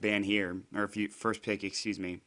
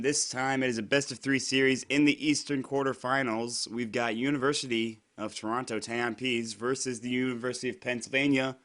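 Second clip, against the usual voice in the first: louder, higher announcer welcome; esports announcer intro; 4 semitones higher, 5 dB louder than usual. Real, not acted. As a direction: open the broadcast with a big, projected greeting. Opens the match broadcast.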